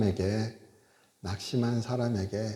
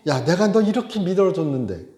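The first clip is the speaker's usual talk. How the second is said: loud, higher scolding rant; mock angry God; 5 semitones higher, 6 dB louder than usual. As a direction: play an exasperated God scolding his prophet. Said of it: "하나님도 열받으실 거 아니에요". (화난 하나님 흉내, 크고 높게 꾸짖듯)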